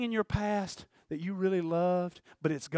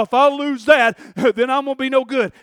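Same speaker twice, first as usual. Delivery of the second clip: preaching at full force; 4 semitones higher, 12 dB louder than usual. Acted. Preacher voicing listener's fears.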